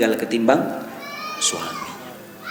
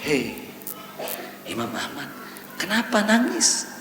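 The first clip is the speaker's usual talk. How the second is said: hushed aside, much softer; imitated whisper; 4 semitones lower, 10 dB quieter than usual. Congregant discreetly consoling the weeping Imam, mock whisper. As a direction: quote a discreet murmur to a grieving man. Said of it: "Dibisikin sama jamaah".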